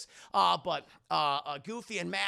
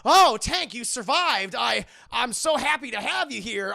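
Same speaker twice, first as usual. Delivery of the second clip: high, loud, overdone relief; gushing false delight; 5 semitones higher, 11 dB louder than usual. Retold or imitated. Bradley greeting the friend he abandoned, gushing delight. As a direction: gush as if overjoyed. Bradley fakes joy at Tank's survival.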